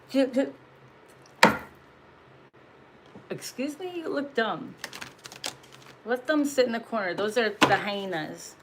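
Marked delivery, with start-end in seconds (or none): 0.1-4.7 higher, incredulous excuse me; sassy retort. Retold or imitated. Confronting the gossipers, a sassy incredulous comeback. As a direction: snap back with disbelief.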